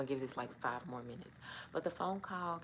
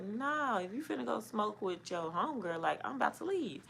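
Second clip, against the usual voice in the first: high, loud, sassy comeback; teen girl sassing her boyfriend with attitude; 8 semitones higher, 4 dB louder than usual. Retold or imitated. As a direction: tell him off with some attitude, pitched up. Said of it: "So I had an attitude".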